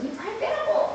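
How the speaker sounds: mother repeating a command in frustration; retold exasperated yell. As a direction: mom snapping at her daughter. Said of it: "그래서".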